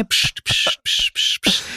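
repeated whispered psst to hush her; imitating a man hushing a woman; shushing hiss. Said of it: "Und dann ist er".

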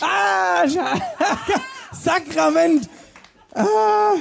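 high, shouted, laughing frustration; exasperated outcry. Real, not acted. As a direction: cry out at your own mistake. Wrong answer, cries out.

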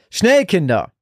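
put-on father yelling children out of the smoke, urgent shout; urgent roar. A narrator's reading. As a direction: bellow at the kids to hurry. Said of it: "brüllte Frank".

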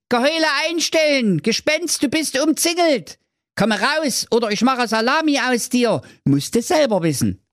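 high mock-commanding knight. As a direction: order it like a tiny hero. Manni commanding.

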